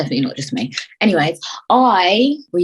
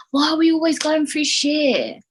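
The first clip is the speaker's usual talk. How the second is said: high, whiny complaining voice; a raised whiny put-on voice; 8 semitones higher than usual. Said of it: "why am I talking like that".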